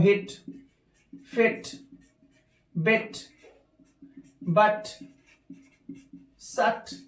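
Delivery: हिट, फिट, बिट, सट with quick clipped vowels; short vowel shown. Shown as a demonstration. Teacher clipping the short vowel in each word. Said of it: "doesn't take time".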